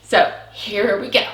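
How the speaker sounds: ordinary talk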